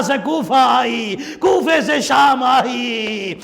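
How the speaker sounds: high, keening elegy climbing into a wail; high-pitched grieving sermon chant; lamenting majlis recital. Acted. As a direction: mourn it out like a zakir near tears. Mourning recital, rising wail.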